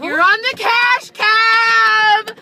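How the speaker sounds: drawn-out, loud and high game show reveal; announcing big news. Acted.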